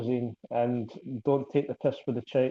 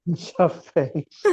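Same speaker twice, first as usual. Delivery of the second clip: laughing; 3 semitones higher, much slower than usual. Real, not acted. Laughing repeat of punchline.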